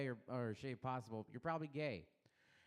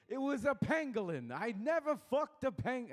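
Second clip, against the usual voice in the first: higher, louder mock Walken accent; 8 semitones higher, 3 dB louder than usual. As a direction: push the fused Walken voice up and louder. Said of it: "impression fusion".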